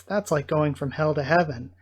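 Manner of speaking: ordinary delivery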